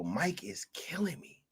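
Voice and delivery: breathless whisper. Real, not acted. Streamer whispering that the reviewer is too funny. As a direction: whisper it, out of laughs. Whispered after laughing fit.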